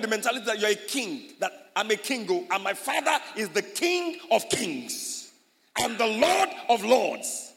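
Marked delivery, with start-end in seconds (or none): 1.7-7.5 loud, slow, proud proclamation of royalty; bold declaration. Shown as a demonstration. Pastor shows the congregation a bold royal claim, slow and loud. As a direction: declare it boldly, chest out. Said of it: "you need to be bold".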